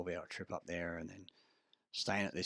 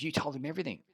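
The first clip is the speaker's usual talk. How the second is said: accusing; 8 semitones higher, 4 dB louder, faster than usual. Retold or imitated. Deborah's accusation retold.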